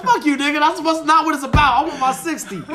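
high, loud retold argument; worked-up mock yelling over money; indignant protest. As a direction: argue back heatedly, playing it up. Retold quarrel with the dad.

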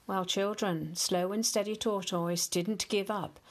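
plain reading voice

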